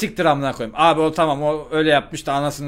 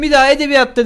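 high, loud staged outrage; fake anger; 11 semitones higher, 7 dB louder, faster than usual. Student to principal, theatrically angry. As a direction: play up the indignation. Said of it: "fake sinirleniş".

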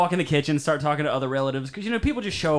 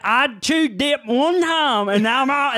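high, loud, drawn-out mock testimonial; disfigured dip chewer; 10 semitones higher, 6 dB louder, slower than usual. Imitating a disfigured man warning everybody off dip, mock testimonial. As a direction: drag it out like a mangled mouth. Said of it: "his face is all disfigured".

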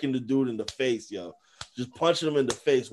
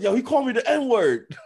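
mock outraged complaint; 13 semitones higher, 6 dB louder than usual. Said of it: "Marcus Smart was like".

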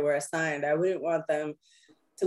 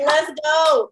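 loud high "let's go"; hyped; 8 semitones higher, 8 dB louder than usual. Retold or imitated. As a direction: fire up the room. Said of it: "I heard it. I was like".

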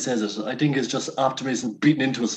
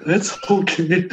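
teacher forgiving; 7 semitones higher, slower than usual. Retold or imitated. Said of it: "And then she just".

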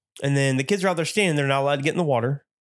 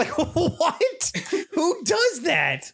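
laughing in disbelief; much slower than usual. Real, not acted. Laughing at the pee story.

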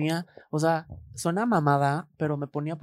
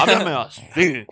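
slow, lowered manly voice; deep slow manly voice; 8 dB louder, much slower than usual. Shown as a demonstration. Demonstrating the manly voice the psychologist demanded, slow. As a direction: show the voice he demanded. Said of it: "me decía".

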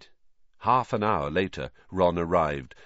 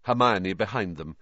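ordinary delivery, nothing marked

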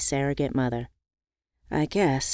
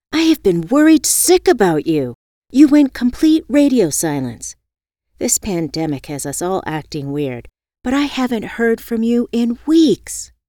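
worried scolding; 5 semitones higher, 7 dB louder than usual. Acted.

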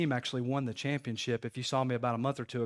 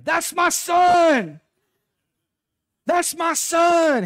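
high, drawn-out full-force yell; proud scream; 13 dB louder, much slower than usual. Retold or imitated. Dad cheering his son's hit from the dugout, proud scream. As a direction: holler it long and high across a ballfield. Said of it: "I would start screaming".

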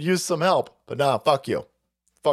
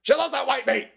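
much higher mock gushing cry; 12 semitones higher than usual. Teasing Elon in a high gushing voice.

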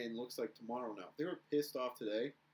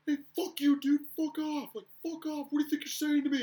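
much higher, loud furious outburst; imitated angry yell; 13 semitones higher, 8 dB louder than usual. Angry player yelling at the scorekeeper from the box, imitated rage. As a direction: act out a player losing it in the penalty box. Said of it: "He was like".

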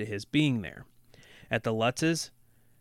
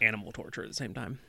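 whispered sarcastic aside; 7 dB quieter, faster than usual. Real, not acted. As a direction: mutter it with dry sarcasm.

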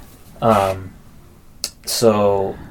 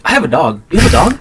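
put-on eager, higher question; mock chattiness; 6 semitones higher, 4 dB louder than usual. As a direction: voice jumping high on the question. Imitates man stalling a scammer.

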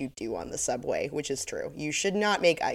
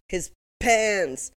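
insistent repeat; 5 semitones higher, much slower than usual. Retold or imitated.

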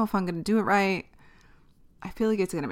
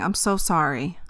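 ordinary delivery

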